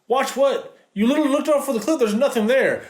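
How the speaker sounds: high-pitched, rushed incredulous rant; exasperated. Real, not acted. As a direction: demand to know what there was to see, pitch jumping up. Ranting about a pointless scene.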